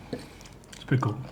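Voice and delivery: soft unvoiced whisper. Real, not acted. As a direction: breathe it almost silently. Repeats remark under breath.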